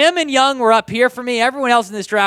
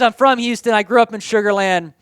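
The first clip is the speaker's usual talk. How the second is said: ordinary talk, nothing marked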